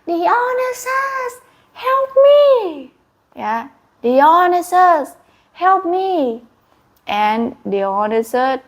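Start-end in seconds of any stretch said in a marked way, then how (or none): 0.1-2.9 high desperate call for help, rising to a shout; high pleading shout for help. Acted. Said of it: "King Medad called". 4.0-6.4 higher, louder call for help; a raised pleading call. Acted. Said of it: "King Medad called".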